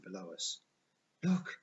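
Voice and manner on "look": high, loud cry of alarm; urgent call. A narrator's reading. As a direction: call out to alert the others. Zia points out sudden danger.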